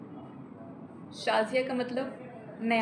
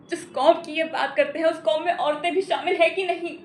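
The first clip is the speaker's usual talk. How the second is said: louder, higher, pressing courtroom challenge; indignant challenge; 4 semitones higher, 6 dB louder than usual. Acted. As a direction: throw the question out in raised-voice indignation. Courtroom plea challenging husband's argument.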